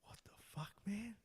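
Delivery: hushed unvoiced whisper. Whispering to Tim as he leaves the bathroom. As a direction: hiss it so nobody overhears. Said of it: "And I'm just like".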